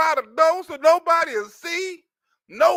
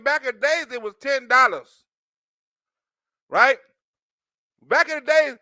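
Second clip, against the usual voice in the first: usual speaking voice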